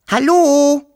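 loud, high-pitched holler; high call. A narrator's reading. Wischel calling to the girl from the bushes, high call. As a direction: call out high, holding the last vowel. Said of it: "rief Wischel".

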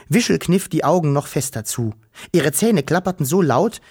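usual speaking voice

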